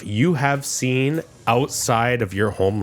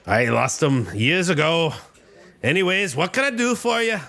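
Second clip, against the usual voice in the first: lightly voiced Scottish dwarf, higher at the end; a hint of Scottish brogue, brisk; Scottish accent; 4 semitones higher than usual. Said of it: "the classic Scottish accent".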